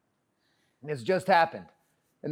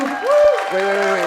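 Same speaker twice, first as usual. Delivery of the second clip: loud, urgent interruption; calling out; 9 semitones higher, 7 dB louder, much slower than usual. Real, not acted. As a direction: call over a clapping crowd to stop them. Halting audience applause to add something.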